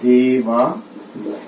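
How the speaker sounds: देवालय stretched very slowly, syllable by syllable; teacher slowly sounding out the word; very slow demo. Shown as a demonstration. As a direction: draw out देवालय to show the long आ. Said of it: "नया शब्द बन गया आपका".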